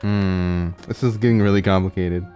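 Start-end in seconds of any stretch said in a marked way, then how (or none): none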